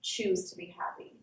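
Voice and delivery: soft low mock musing; sarcastic mutter. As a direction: mutter it back in disbelief.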